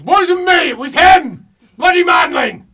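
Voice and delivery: high, roaring accusation; angry denial. Acted. Character blaming the manling, furious roar. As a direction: bellow it, shifting the blame. Character shouts blame at another.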